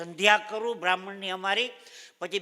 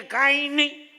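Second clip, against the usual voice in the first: high, lightly voiced old lady; old woman voice; 6 semitones higher, 5 dB louder, slower than usual. Old Kashiba telling the narrator that nothing was stolen. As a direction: answer like a casual old woman.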